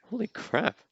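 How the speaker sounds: high, sudden burst of surprise; startled exclamation. Real, not acted. Reacting to a startling scene, a sudden high exclamation. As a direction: blurt it out, caught off guard. Sudden strange sight on screen.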